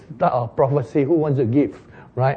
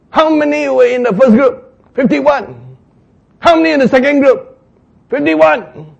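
high, loud question and answer; military exchange; 9 semitones higher, 5 dB louder than usual. Acted. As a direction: rattle off the count. Captain and soldier count.

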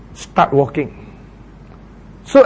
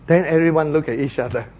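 ordinary delivery

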